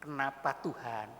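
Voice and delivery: low, soft, slow plea; acted subdued grief; anguished questioning. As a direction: ask God slowly and quietly, struggling. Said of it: "itu seringkali bergumul ya".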